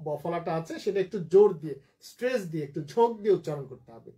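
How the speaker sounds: normal talking voice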